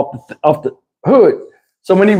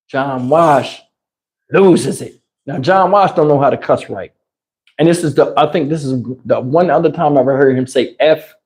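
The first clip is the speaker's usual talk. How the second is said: ordinary talk, nothing marked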